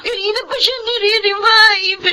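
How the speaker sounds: crying while telling the news; tearful. Acted. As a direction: speak through tears, voice catching. Daughter at hospital, crying.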